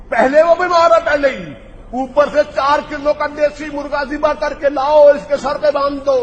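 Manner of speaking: high, loud mocking repeat of the remedy; outraged mockery. Real, not acted. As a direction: throw the absurd cure back, scandalised.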